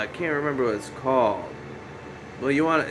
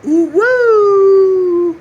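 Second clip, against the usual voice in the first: long, high, loud wrestler's woo yell; Ric Flair woo; 11 dB louder than usual. Retold or imitated. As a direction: let rip a wrestling-style woo. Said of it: "Ric Flair woo".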